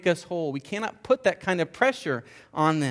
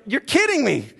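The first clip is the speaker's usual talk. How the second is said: higher, louder exasperation in Jesus' voice; exasperated Jesus; 8 semitones higher, 4 dB louder than usual. Retold or imitated. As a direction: throw up your hands as a frustrated Jesus. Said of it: "Jesus would be like".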